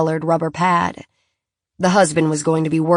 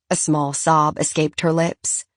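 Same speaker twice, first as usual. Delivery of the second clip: usual speaking voice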